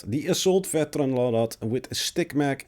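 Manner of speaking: ordinary talk, nothing marked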